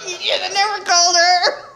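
high, laughing self-mockery; real laughter at own foolishness. Real, not acted. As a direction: giggle through it at yourself.

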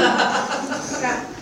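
high laugh. Real, not acted. Laughs at his own scheme.

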